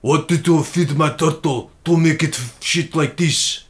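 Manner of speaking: slow, heavy Russian accent; stretched-out mock Russian accent. Shown as a demonstration. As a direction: put on a Russian speaker's English.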